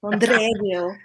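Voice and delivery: put-on Londreville rolled out in a Castilian accent. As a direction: give Londreville a Castilian lilt. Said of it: "in her Castilian accent".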